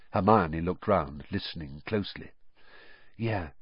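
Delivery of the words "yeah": softly spoken, much quieter; soft, hushed yes. A narrator's reading. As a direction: keep it down, just between friends. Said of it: "said Harry softly".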